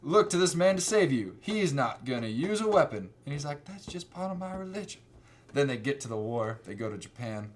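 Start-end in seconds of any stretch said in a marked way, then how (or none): 0.0-3.1 loud, commanding mock officer; higher, emphatic character voice; Vince Vaughn. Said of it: "This is Vince Vaughn for some reason". 3.7-5.0 mock Southern accent, pitched up; Southern boy. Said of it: "he's, like, a Southern boy".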